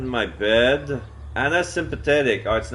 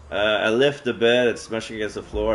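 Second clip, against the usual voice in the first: ordinary delivery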